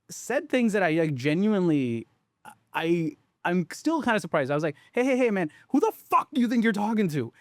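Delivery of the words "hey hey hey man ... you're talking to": high, heated retold snap at the caller; angry outburst. Founder retelling how he yelled at a shipping clerk, heated high outburst. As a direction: relive losing your temper, pitched high. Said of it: "lost my mind".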